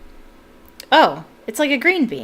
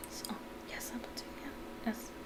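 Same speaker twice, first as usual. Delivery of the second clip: soft breathy whispered aside; 3 semitones higher, 22 dB quieter than usual. Real, not acted. Reader muttering to herself mid-chapter, whispered. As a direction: whisper it to yourself.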